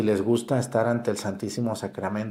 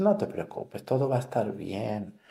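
soothing nurse; 5 dB quieter than usual. Retold or imitated. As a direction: soothe a nervous patient before the needle. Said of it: "y te dice".

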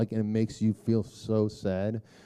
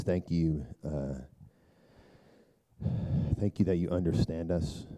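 closing prayer; 3 semitones lower than usual. Real, not acted. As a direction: lead the room gently into prayer.

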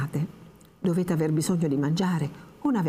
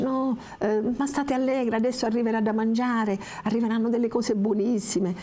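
put-on coaxing old woman; 7 semitones higher than usual.

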